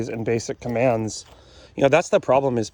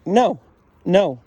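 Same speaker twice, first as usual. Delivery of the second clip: sudden higher, punchy dog correction; firm correction; 6 semitones higher, 4 dB louder than usual. Real, not acted. Trainer breaks off explaining to correct Frida, firm correction. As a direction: cut in to stop the dog.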